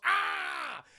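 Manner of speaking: high, loud retold scream; mock victim's yell. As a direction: cry out as if flung away. Father screams as creature throws him.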